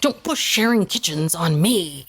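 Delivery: low, serious mock pleading; dead serious. Retelling a husband's refusal to share kitchens, mock serious. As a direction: imitate a serious man warning people off. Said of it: "Cody was so serious".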